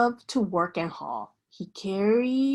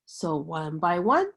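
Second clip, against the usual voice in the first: plain reading voice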